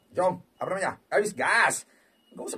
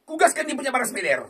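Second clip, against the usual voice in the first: usual speaking voice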